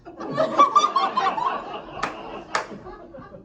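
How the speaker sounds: very high squeal of laughter. Real, not acted. Comic squealing with laughter at the question. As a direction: shriek with laughter.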